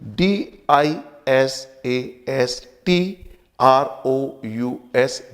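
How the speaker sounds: letter by letter, slow and clear; slow spelling dictation; spelled out slowly. Shown as a demonstration. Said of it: "spelling हो गई डी".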